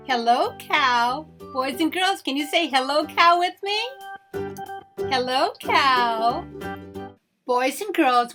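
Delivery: ordinary delivery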